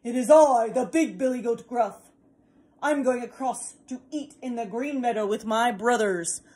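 loud, bold big goat; big goat answering the troll unafraid, loud; big billy goat. Acted. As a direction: stand up to the troll boldly.

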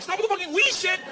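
high-pitched frantic plea; exasperated high yelp; panicked protest. Retold or imitated. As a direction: beg him to stop, voice shooting up. His panicked reaction to Igor.

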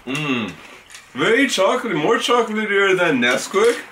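chewing between words; talking through a mouthful; mouth full of cereal. Real, not acted. Seen 1: Red-shirt speaker takes spoonful, chews, then talks with food in mouth.

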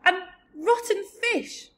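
high, loud, slow revulsion; revolted little girl, drawn out; gasping disgust. A narrator's reading. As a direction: wail it, sickened by the taste. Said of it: "she gasped".

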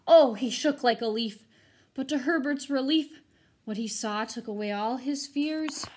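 put-on lively rhyming read-aloud for kids; storybook reading. A narrator's reading. Teacher reading a rhyming ghost story to her students, animated. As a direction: read it to the class with bounce and drama.

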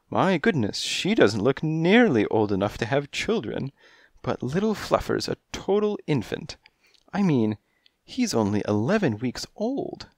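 high, coy feline voice; high kittenish client voice; coy cat lady. A narrator's reading. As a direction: purr it like a kittenish femme fatale.

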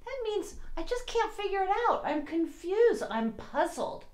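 high, fast, baffled voice; puzzled. Pretend. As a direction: act out being confused. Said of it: "let's pretend we're all puzzled".

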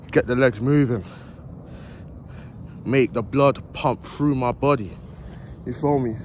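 ordinary talk

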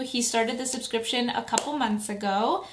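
ordinary talk, nothing marked

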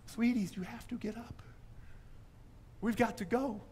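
a hint of a sweet, gentle wife waking the kids. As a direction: coax them up lightly. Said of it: "Amy's sweet, gentle voice".

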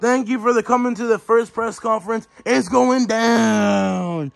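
hammed-up announcer drawing out the opening; press conference host. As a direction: sell the fight like a promoter.

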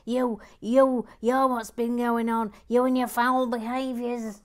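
hammed-up scolding; camp mock accusation. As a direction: point the finger in camp outrage. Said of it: "Gary Blair says".

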